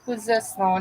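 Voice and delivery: ordinary delivery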